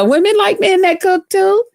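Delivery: ordinary talk, nothing marked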